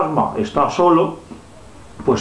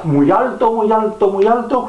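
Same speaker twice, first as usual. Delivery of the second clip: each "muy alto" pitched higher; climbing higher; 8 semitones higher than usual. Pretend. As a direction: rise in pitch with each repeat. Rising higher while flying.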